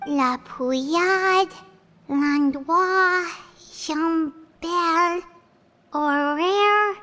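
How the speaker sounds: slow, drawn-out list reading; stretched-out, careful name-by-name reading. Acted. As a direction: read each name slowly, one by one. Slowly reading names off a list.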